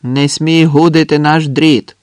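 acted soldier's yell; shouted warning. A narrator's reading. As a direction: shout it as an indignant threat. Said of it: "крикнув хтось".

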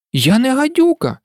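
high, firm denial; indignant. A narrator's reading. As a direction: protest the insult. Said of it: "обурено заперечила Аліса". (обурене заперечення)

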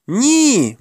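high, drawn-out cry; desperate denial. A narrator's reading. Boy's desperate denial at sword point.

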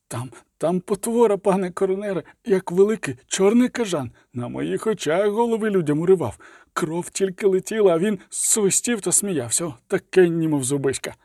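high, shaken report of the monster; trembling voice. A narrator's reading. A pale soldier tells the coroner about the monster, trembling. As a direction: tell it with your voice shaking. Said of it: "а голос тремтів".

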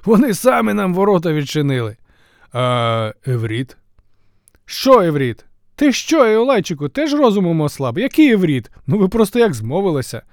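high and loud, bursting with news; excited messenger. Acted. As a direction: blurt it all out.

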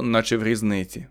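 usual speaking voice

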